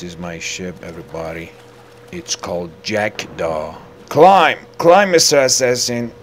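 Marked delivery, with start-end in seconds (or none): 4.1-6.1 high, loud mock command; shouted order. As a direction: urge him up with a shout. Orders character to climb.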